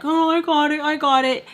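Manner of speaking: playfully mimicked eagerness; mock eager offer. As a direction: put on a keen "let me pay". Eager offer to pay, retold.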